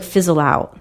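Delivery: ordinary talk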